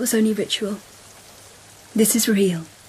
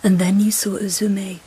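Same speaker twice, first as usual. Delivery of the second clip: ordinary delivery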